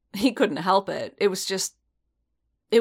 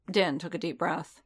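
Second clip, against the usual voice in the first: ordinary delivery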